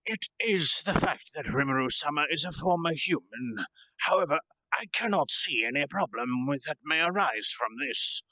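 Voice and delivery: measured, solemn retainer addressing his lord; earnest old swordsman, lightly voiced; serious old retainer. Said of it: "Hakuro said with his serious face".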